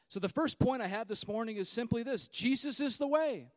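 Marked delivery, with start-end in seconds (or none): none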